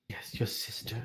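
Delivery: voiceless whisper of a secret; hushed confession. Acted. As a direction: breathe it so nobody overhears. Maester reveals dark secret.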